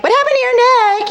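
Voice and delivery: imitating people asking; mock concerned asker. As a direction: lightly imitate a nosy friend. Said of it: "everybody was asking me".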